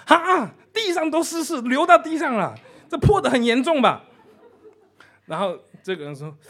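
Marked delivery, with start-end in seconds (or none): 0.1-4.0 much higher and louder, panicked, laughing; a frantic clerk on the phone; alarmed. Retold or imitated. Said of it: "对方更紧张了".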